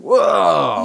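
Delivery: loud, high, drawn-out exclamation; mock shock. Podcaster imitating viewers' reaction to something messed up, loud mock shock. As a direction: exaggerate a startled reaction.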